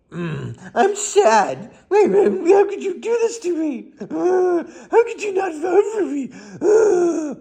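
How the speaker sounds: mocking whimper of a sore loser; whiny mock voter. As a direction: ham up a sulking loser.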